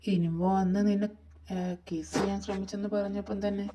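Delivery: usual speaking voice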